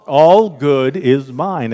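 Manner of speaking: slow and loud, drawn out; ringing, stretched-out declaration; emphatic group affirmation. Real, not acted. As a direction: proclaim it slowly for the final round. Final, louder repeat of affirmation.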